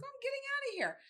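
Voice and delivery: put-on high, determined old lady; defiant old mom. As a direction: insist on leaving, chin up. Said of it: "And she's like".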